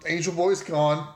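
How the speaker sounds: normal talking voice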